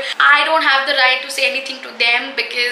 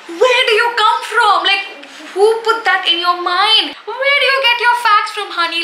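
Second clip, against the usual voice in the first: sassy disbelief; 7 semitones higher than usual. Real, not acted. Mocking a friend's silly belief.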